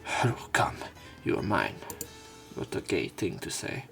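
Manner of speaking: usual speaking voice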